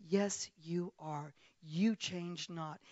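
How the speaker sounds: very slow, deliberate affirmation; slow declaration. Retold or imitated. Dina answering God as she stands, slow and firm. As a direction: draw out each word of faith.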